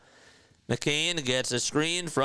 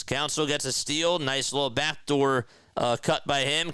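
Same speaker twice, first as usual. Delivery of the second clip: ordinary talk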